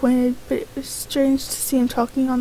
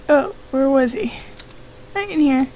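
ordinary talk, nothing marked

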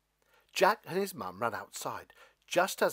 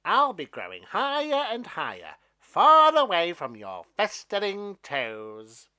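loud, higher, boastful stalk voice; a mean plant showing off to a boy; gleeful mean stalk voice; mean beanstalk; 6 semitones higher, 11 dB louder than usual. Acted. Stalk figure gloats.

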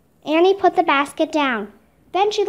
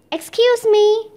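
higher, polite call to strangers; 6 semitones higher, 3 dB louder, slower than usual. A narrator's reading. Annie to two women at their windows, calling out. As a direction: lightly call for someone's attention. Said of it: "Annie called to the women".

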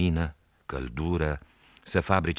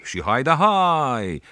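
high, loud, slow call across the water; long sung-out raftsman's cry; boy imitating the rafters' call, high and loud; rafter's call; 9 semitones higher, 8 dB louder, much slower than usual. Shown as a demonstration. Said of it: "Uite așa".

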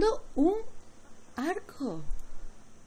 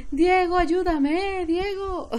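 high, slow plea broken by laughter; laughing plea; 10 semitones higher, 4 dB louder, much slower than usual. Real, not acted. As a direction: beg for help while laughing. Dying in game, begging partner.

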